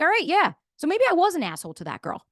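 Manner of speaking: lightly played excuse-maker; mock self-justifying. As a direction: talk yourself out of blame. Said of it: "you're like".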